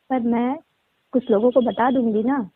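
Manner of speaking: normal talking voice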